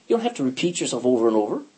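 ordinary talk, nothing marked

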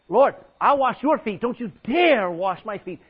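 loud, insistent, slow on dare; insist, daring him; determined Peter. Acted.

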